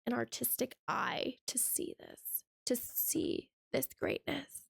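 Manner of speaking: lightly mocking pretentious artist voice, hushed at the end; snooty artist. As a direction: talk down to them, fading into a slow hushed reverence. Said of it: "We're basically told".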